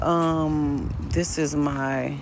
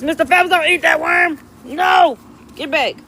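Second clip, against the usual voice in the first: much higher, louder sharp yell; shouting at dog; 13 semitones higher, 7 dB louder than usual. Real, not acted. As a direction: stop the dog before he eats it. Dog about to eat worm.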